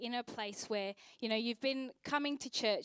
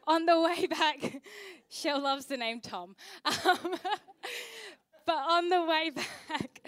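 laughing; 6 semitones higher, 5 dB louder than usual. Real, not acted. Laughing while telling about Tom.

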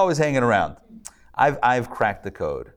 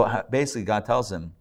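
usual speaking voice

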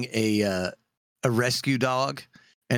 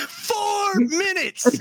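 mock time call; much slower than usual. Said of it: "was giving 'em a time count too".

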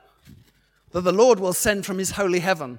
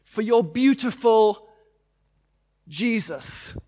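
roaring rallying speech; 4 semitones higher, 4 dB louder than usual. Real, not acted. Preacher urges congregation to stand.

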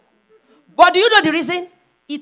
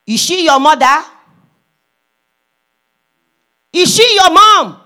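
insistent questioning; 5 semitones higher, 10 dB louder than usual. Retold or imitated. Caller questioning the preacher's daughter, loud and insistent. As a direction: press the question harder and louder.

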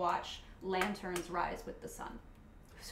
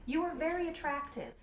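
flat compliment, higher; deadpan lizardfolk bard; blunt bard voice; 6 semitones higher than usual. Acted. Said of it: "your default bard voice".

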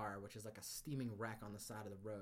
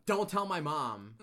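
slow, loud, higher mock plea; mock desperate plea; 4 semitones higher, 12 dB louder, slower than usual. Patient begging nurses not to call mom, slow mock plea. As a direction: drag it out like a guilty kid. Scribbled plea, retold for laughs.

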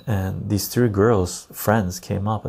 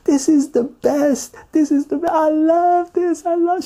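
very high, slow, drawn-out raving; hammed-up blissed-out girl; ecstatic festival girl; 3 dB louder than usual. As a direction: gush it in a mock squeal, stretching the words. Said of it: "she's, like, going like this".

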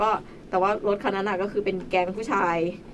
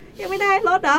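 high, loud mock surprised question; 13 semitones higher, 7 dB louder than usual. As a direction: pitch it way up as if amazed they're still waiting. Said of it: "เขาถามว่า".

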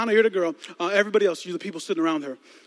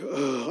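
groan; 6 semitones lower, 6 dB quieter than usual. Retold or imitated. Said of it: "I was like".

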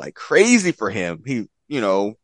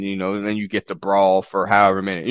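ordinary delivery

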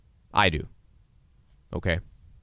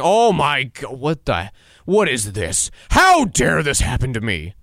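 mock shock; 3 semitones higher, 5 dB louder, slower than usual. Mocking someone shocked by trouble.

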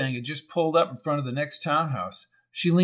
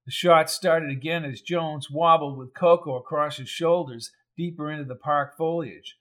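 usual speaking voice

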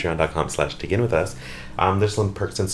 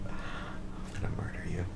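whisper; 3 semitones lower, 19 dB quieter, slower than usual. Pretend. Mock threat to friend.

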